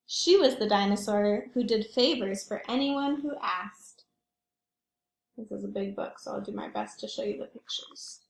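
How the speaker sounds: usual speaking voice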